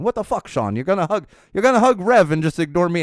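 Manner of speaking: imitating an English friend teasingly, not quite nailing the accent. A friend jealously teasing Sean over the hug, mock English accent. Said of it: "I can't do a good English accent".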